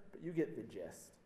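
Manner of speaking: ordinary delivery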